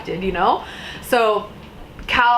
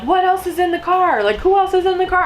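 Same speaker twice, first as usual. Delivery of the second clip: higher, urgent officer questions; cop questioning; 9 semitones higher than usual. Retold or imitated. Police asking about the car, urgent. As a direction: demand it like a wary cop. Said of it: "they're like".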